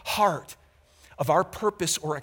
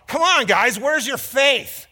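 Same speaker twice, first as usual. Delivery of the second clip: higher, louder teasing rebuke; a hint of condescension in Jesus's voice; mock condescending Jesus; 8 semitones higher, 7 dB louder, slower than usual. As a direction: chide them like a disappointed teacher. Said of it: "It felt like Jesus was going".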